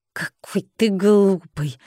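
slow, hissed contempt; venomous hiss. A narrator's reading. Brothel owner crawls back from dragon, scornful hiss. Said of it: "зашипела она".

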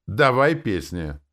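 loud, drawn-out demand; crowd yelling. A narrator's reading. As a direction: yell for the songs. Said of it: "орала публика". (громко требует из толпы)

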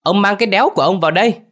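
fast, high, loud yell; wife's rapid high-pitched holler; angry call from inside. A narrator's reading. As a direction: yell at him to get indoors. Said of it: "tiếng mụ Bưởi vọng ra".

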